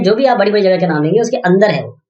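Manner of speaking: ordinary delivery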